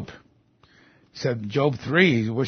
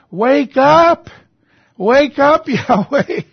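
high, loud wake-up shout; bold cry to God; 11 semitones higher, 8 dB louder, slower than usual. Acted. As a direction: shout up at God as if to rouse Him. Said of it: "Here's David saying to Almighty God".

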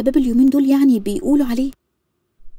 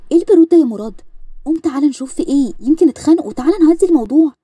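higher and louder, pressing him; urgent, agitated wife; 6 semitones higher, 5 dB louder than usual. Acted. Wife urging her sleepy husband to go calm the fight, higher and louder. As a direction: scold him into getting up, urgently.

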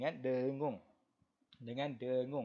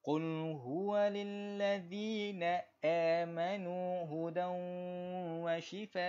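slow, higher melodic tajwid chant; Quran recitation; 4 semitones higher, slower than usual. Shown as a demonstration. Teacher reciting verse for the class, slow melodic tajwid. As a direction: recite for students to follow.

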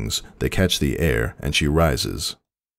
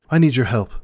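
high, louder urgent plea; 9 semitones higher, 4 dB louder, faster than usual. A narrator's reading. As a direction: beg for help, breathless and high. Celestia pleading with stallion.

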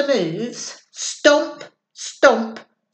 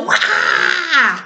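jump-scare shout; 8 semitones lower than usual. Shown as a demonstration.

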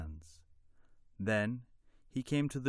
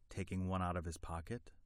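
plain reading voice

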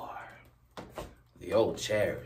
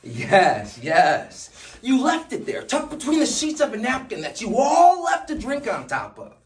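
high-pitched, loud, breathless-paced run-on rant; frantic shouting; 13 dB louder than usual. Acted. Speaker frantically retraces the lost note for Jack, shouting high. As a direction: shout it far above your usual pitch, piling event on event.

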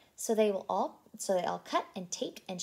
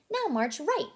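calling out; 7 semitones higher, 6 dB louder than usual. A narrator's reading. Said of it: "called Blue".

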